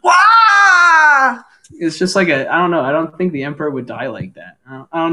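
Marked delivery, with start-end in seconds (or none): 0.0-1.4 shrill, loud high wail; Palpatine death cry. Retold or imitated. Podcaster imitating Palpatine's odd death wail. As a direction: wail like the Emperor falling. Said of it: "a weird one 'cause he goes".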